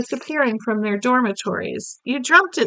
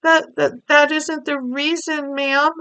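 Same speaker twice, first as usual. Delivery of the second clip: quivering voice; 6 semitones higher than usual. Acted. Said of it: "voice was quivering terribly".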